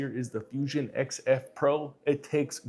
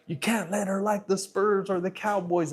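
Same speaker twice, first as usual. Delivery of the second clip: imitating his wife, higher and mad; mock the wife's scolding, pitched up; higher-voiced imitation of an upset wife; 7 semitones higher than usual. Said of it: "my wife was all mad, like".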